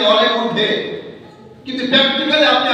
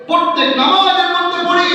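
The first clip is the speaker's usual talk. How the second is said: higher, chanted repeat with a stretched ending; rousing preacher's call; 7 semitones higher than usual. Shown as a demonstration. Preacher reminds worshippers of every prayer, rousing chant. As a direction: ring it out to the crowd.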